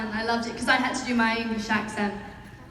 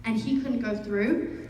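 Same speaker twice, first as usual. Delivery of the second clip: ordinary talk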